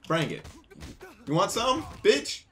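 hammed-up street taunt; taunting shout. Taunting a fight.

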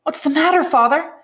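alarmed son asking what's wrong; anxious exclaim. Acted. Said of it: "exclaimed the young man anxiously".

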